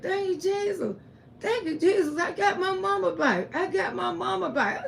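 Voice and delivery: high, overjoyed thanks, laughing at the end; joyful son. Retold or imitated. Son hugging his healed mama, voiced high and overjoyed. Son hugs recovered mother.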